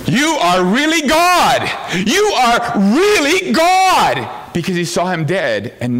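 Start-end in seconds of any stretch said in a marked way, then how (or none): 0.1-4.3 slow, much higher, louder cry of awe; awed cry. Retold or imitated. Thomas facing the risen Jesus, crying out in awe. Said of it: "He was saying".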